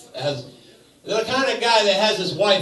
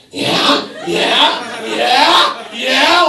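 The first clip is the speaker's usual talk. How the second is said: rising, louder yeah yeah yeah, each one higher; mock eager woman; 7 semitones higher, 8 dB louder, much slower than usual. Comic voicing the proud woman, rising mock-eager yeahs. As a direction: egg him on, climbing with every yeah. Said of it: "she's just looking at me like".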